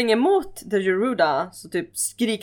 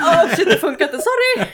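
mock panicked apology; 9 semitones higher, 5 dB louder than usual. [name] panicking after failed attack.

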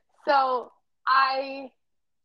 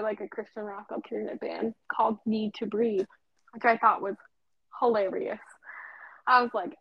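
ordinary talk, nothing marked

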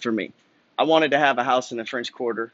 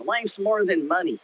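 slow, higher mock whine of critics; 6 semitones higher than usual. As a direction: parody the naysayers, drawn out. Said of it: "I get people all the time".

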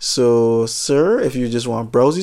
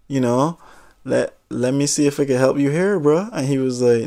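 ordinary delivery

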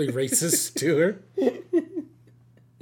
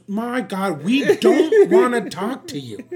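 put-on higher, louder, slow exasperated girl, laughing; sheriff's office girl hanging up on a caller, exasperated; 8 semitones higher, 8 dB louder, slower than usual. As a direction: play the fed-up woman on the phone, half laughing.

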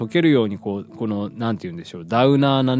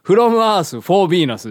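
grand proclamation; 10 semitones higher, 9 dB louder, much slower than usual. Acted. Proclaiming the song title.

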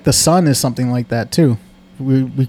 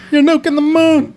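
much higher teasing accusation; high playful jab; mock squeak; 12 semitones higher than usual.

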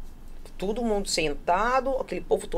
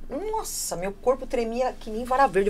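normal talking voice

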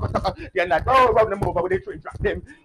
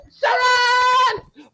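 high shouted call; 3 dB louder than usual. Real, not acted. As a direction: shout the name across the street. Calling out a name.

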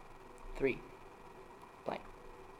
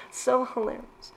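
laughing; 11 semitones higher, slower than usual. Real, not acted. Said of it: "I'm laughing so hard".

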